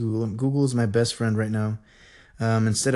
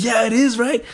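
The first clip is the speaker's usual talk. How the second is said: retold excitement; 11 semitones higher, 5 dB louder than usual. Retells his thrilled reply to her.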